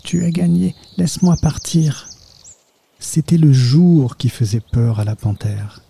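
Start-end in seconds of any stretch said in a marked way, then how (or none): 0.0-2.1 higher, frightened begging; a read-aloud panther pleading in fear, higher; trembling plea. A narrator's reading. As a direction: beg for mercy, scared and beaten. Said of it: "Sa voix tremblante supplia".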